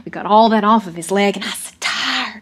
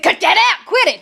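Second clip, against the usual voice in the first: high, loud, sharp telling-off; irritated shout, much higher than narration; annoyed foreman; 11 semitones higher, 5 dB louder than usual. Acted. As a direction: yell at the boys to stop fooling around. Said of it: "He didn't like that horseplay".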